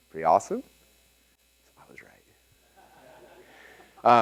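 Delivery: slow, drawn-out whisper; playful whispered told-you-so; gloating whisper. Acted. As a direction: whisper it like a delicious secret. Gloats after reading supporting passage.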